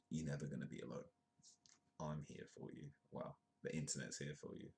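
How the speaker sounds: very soft, sincere comfort; hushed and tender; gentle reassurance. Real, not acted. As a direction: softly tell them they're not alone. Reassures viewers about mental health.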